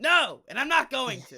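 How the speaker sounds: high, loud mock outburst; exaggerated shouted refusal; defiant shout. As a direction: yell it back at a pestering canvasser. Retold outburst at a canvasser.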